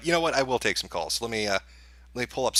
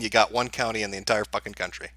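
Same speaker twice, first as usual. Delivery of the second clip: ordinary talk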